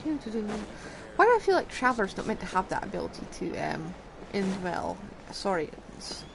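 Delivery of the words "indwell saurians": normal talking voice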